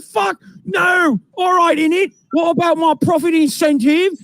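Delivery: high, loud, slow London geezer shout; shouting London accent. Retold or imitated. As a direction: belt it out in a brash London accent. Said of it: "they're speaking in English in the U K".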